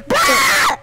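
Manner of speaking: high, loud exasperated yell; a high frustrated bruh; frustrated shout. Real, not acted. Frustrated at laughing friend.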